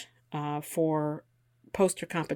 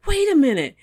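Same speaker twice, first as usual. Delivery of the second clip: high, loud, dawning recognition; suddenly remembering together, voice up high; an old friend realizing who you are, high and loud; excited realization; 4 semitones higher, 7 dB louder than usual. Retold or imitated. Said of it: "they were like".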